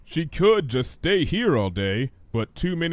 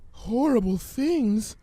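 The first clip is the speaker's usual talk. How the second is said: whimper; 10 semitones higher, slower than usual. Acted. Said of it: "she whimpered".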